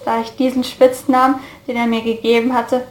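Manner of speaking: ordinary delivery, nothing marked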